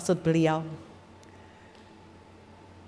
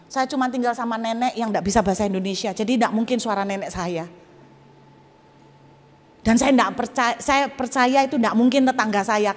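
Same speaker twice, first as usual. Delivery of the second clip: ordinary delivery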